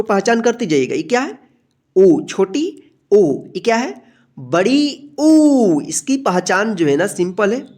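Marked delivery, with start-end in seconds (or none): 4.4-5.8 बड़ी ऊ said very slowly, ऊ stretched out; long drawn uu. Shown as a demonstration. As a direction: draw the ऊ out long. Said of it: "अगर इस तरह से पूंछ खिंचा हुआ रहेगा".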